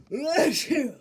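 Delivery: sudden loud sneeze. Acted. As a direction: blast out a sneeze. Hay fever sneezing fit.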